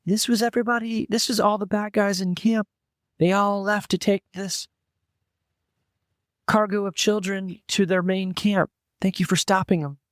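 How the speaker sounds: high-pitched halfling villager; halfling woman. Pretend. Halfling thanking the adventurers for stopping the raiders, high little woman's voice. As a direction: raise the pitch for a small female creature. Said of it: "And she says".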